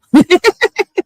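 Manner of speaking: loud, high laugh; high burst of laughter. Real, not acted. After mentioning his purple lips, a high loud laugh. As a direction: break into a big cackle.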